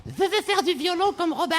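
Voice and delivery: put-on high lisping little girl; slushy lisp. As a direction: lisp it like a small child. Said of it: "Elle zozotait".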